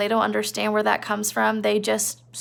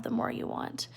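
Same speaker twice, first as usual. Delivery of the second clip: "the more you want" whispered, breath only; 13 dB quieter than usual. Shown as a demonstration. Teacher whispering the point about power to the class, breath only. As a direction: drop to a whisper on the echo.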